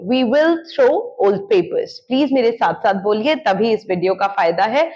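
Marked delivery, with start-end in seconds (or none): 0.1-1.9 each word drawn out slowly for learners; slow model sentence. Shown as a demonstration. Teacher to students, slow clear model sentence. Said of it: "हम पुराने papers फेंक देंगे".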